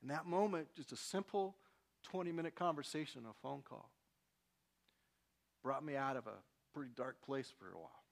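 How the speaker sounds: ordinary talk, nothing marked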